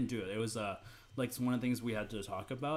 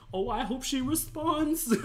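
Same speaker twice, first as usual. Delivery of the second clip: hopeful guy; 11 semitones higher, slower than usual. Retold or imitated. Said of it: "The guy's just like".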